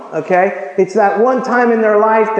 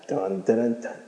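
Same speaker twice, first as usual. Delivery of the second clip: low, quiet dun dun dun; low mock suspense sting; 9 semitones lower, 11 dB quieter than usual. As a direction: hum a mock dramatic sting. Dramatic sound effect, joking.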